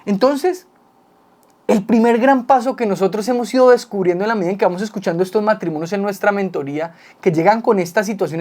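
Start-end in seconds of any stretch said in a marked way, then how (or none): none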